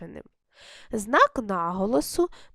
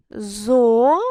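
ЗОШИТ broken into syllables, long ЗО, then ШИТ; Зошит in two separated beats; split into syllables; 6 dB louder than usual. Shown as a demonstration. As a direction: say Зошит syllable by syllable, drawn out and loud. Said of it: "скільки с- у слові зошит складів".

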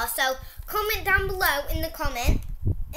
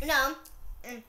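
talking while chewing candy; slightly muffled chewy speech; mouth partly full; 5 semitones lower, 5 dB quieter than usual. Real, not acted. Seen 3: Both girls eat red candy; speaker unclear, mouths busy while talking.

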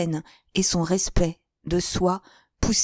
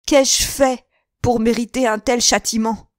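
high, loud protest; raging outcry; 5 semitones higher, 5 dB louder than usual. A narrator's reading. Son protesting his punishment to his father, loud and high. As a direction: hurl the question at the heavens. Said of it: "s'écria-t-il".

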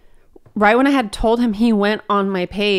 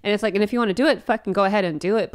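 usual speaking voice

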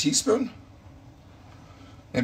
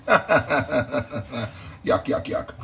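laughing mock disgust; 4 semitones higher, much slower than usual. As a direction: giggly fake disgust. Laughing at cauliflower colour joke.

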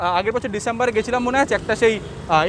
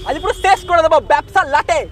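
raised, hammed-up threat to shut them down; mock threat; 6 semitones higher than usual. Vlogger jokingly threatens the restaurant, hammed-up raised threat. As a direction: pretend to wreck the business.

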